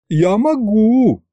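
high, drawn-out creature voice; volunteer slowly, pitched right up; dragon's eager offer. Acted. Middle dragon head volunteers to eat soldiers, eager high drawl.

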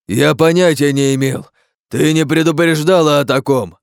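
loud, high protest; angry reproach. Acted. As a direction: accuse her heatedly.

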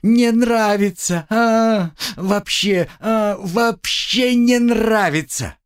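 high, slow drawn-out wail; pained protest. A narrator's reading. Girl protests in pain.

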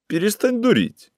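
high, sharp angry retort. A narrator's reading. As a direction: snap it at him, pitched well up. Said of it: "гневно сказала женщина".

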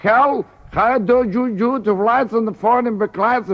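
high-pitched gibberish imitation of English; slow, high mock-English pleading; mock English accent. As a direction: cry for help as a caricature Englishman. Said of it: "El inglés se queda mirando".

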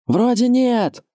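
very high, held-out call; sentry's high sustained answer; lookout calling back. A narrator's reading. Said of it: "ответил дозорный".

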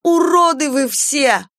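high, loud, slow hysterical scream. Acted. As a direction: scream it out in tearful rage. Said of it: "прокричала Алиса".

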